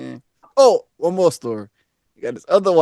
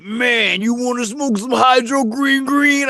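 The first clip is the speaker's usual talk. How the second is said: imitating the man; 9 semitones higher, slower than usual. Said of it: "He was like".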